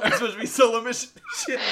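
real helpless laughter through the line; laughing. Real, not acted. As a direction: crack up while protesting. Player cracks up at the outcome.

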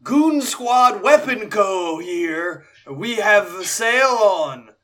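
booming town-crier proclamation, high and loud; magically amplified voice. Acted. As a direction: bellow it like a herald, slow. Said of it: "cast Thaumaturgy to make my voice louder".

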